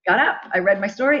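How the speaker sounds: usual speaking voice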